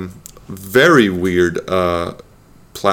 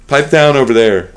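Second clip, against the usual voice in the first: raised, higher mock scolding; calling out; 5 semitones higher, 5 dB louder than usual. Real, not acted. Host shushing person off the podcast, calling out. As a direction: call across the room to hush someone.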